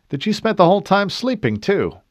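teasing. A narrator's reading. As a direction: rib her playfully. Teasing squadmate.

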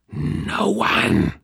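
loud, raspy creature refusal; monster's snarl. A narrator's reading. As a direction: snarl it like a cornered demon. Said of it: "the nightmare snarled".

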